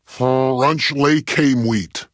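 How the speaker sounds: slow, drawn-out showman's catchphrase; ultimate catchphrase. Shown as a demonstration. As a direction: stretch every syllable out like a grand reveal. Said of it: "one ultimate breeve to end all breves".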